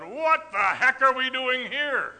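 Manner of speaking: high, loud, drawn-out mock wail; alarmed little boy. Dad imitating his son startled by a cow, mock-alarmed yell. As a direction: imitate a toddler in a comic panic.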